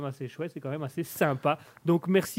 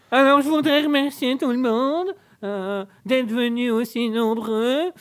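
formal speech; 6 semitones higher, 6 dB louder, slower than usual. Pretend. Mock formal thank-you speech.

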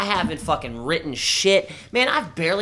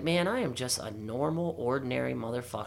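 muttering to himself; 3 semitones lower, 9 dB quieter than usual. Retold or imitated. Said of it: "I was like".

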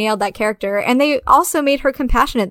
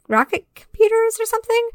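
mocking name; 12 semitones higher than usual.

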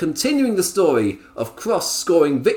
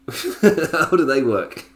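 mock bafflement; 5 semitones higher, 4 dB louder, much slower than usual.

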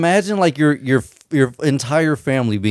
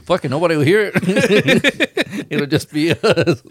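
higher, slow words through real laughter; laughing; 7 semitones higher, slower than usual. Real, not acted. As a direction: giggle the words out slowly. Joking about swearing on mic.

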